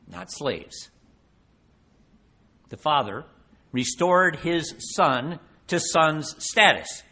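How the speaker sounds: normal talking voice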